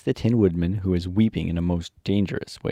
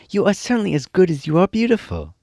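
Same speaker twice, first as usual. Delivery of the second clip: put-on a higher, little girl voice for Dorothy; higher storybook girl voice; Dorothy's voice; 11 semitones higher, 4 dB louder than usual. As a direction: speak up as young Dorothy, admiring.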